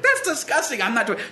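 put-on high, indignant child refusing flat out; disgusted kid. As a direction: play the youngest son who won't drink it. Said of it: "And he's like".

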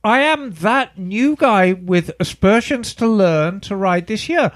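normal talking voice

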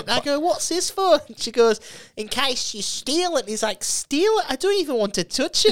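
high, loud comic impression; worked-up stand-up punchline delivery. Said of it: "How good is his bit about".

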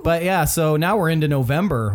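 ordinary delivery

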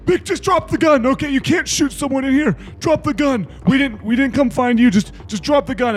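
loud, high-pitched frantic pleading; urgent shouting. Acted. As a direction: yell at him mid-struggle to disarm him. Acts out wrestling over the gun.

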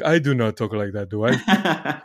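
imitating mom's Guadalajara accent; lightly Mexican-accented protesting mom. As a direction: play mom denying it in her Mexican accent. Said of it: "your accent from Guadalajara is still".

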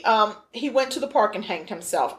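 usual speaking voice

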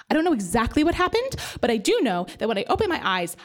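normal talking voice

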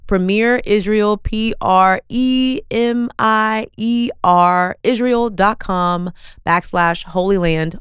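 slow, deliberate spelling for the people in back; spelled out slowly. Shown as a demonstration. Host repeating the website for the people in the back, spelled out slowly. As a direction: repeat it carefully, letter by letter. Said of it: "one more time for the people in the back".

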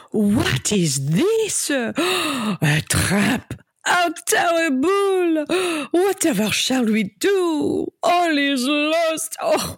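gasping, wailing melodrama, slowed and high; hammy stage actor. Acted. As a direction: overplay the despair like bad theatre. Feigned melodrama to spring trap.

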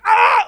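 high loud panicked scream; mock scream. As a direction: shriek in fake terror. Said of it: "screaming loud".

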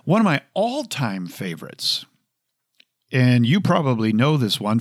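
ordinary delivery

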